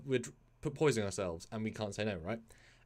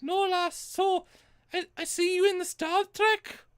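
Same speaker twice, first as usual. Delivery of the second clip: much higher, slow muddled Celtic puppet; slower than usual. Acted. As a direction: ask it like a nosy high-pitched puppet. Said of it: "Are you Irish now?".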